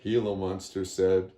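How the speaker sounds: plain reading voice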